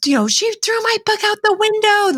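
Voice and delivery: put-on high little-kid voice, tattling; three-year-old's voice. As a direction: squeak it out like a toddler telling on someone. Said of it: "she goes".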